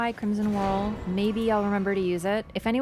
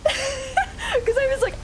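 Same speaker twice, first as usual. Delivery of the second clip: laughing; much slower than usual. Real, not acted. Laughing retelling death.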